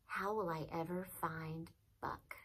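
softly voiced worried question to oneself; very soft, as if thinking aloud; hushed self-questioning; asking himself. A narrator's reading. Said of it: "I asked myself".

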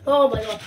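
pained gasp; gasp at sourness. Real, not acted. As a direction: gasp from the sour taste. Reacting to sour candy.

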